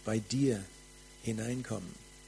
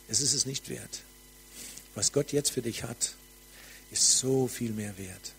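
ordinary delivery

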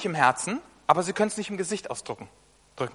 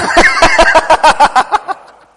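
high, loud burst of laughter; loud, rising laugh; 10 semitones higher, 16 dB louder than usual. Real, not acted. Laughs at his old self.

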